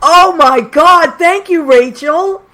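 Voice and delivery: high, slow delighted gush; thrilled thank-you; delighted exclamation. Real, not acted. Host reacts to praise.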